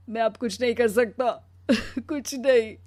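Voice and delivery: giving up, slowing at the end; broken resignation. Acted. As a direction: subside into a tearful, defeated close. पति हार मानकर टूट जाता है. (टूटकर हार मानती आवाज़)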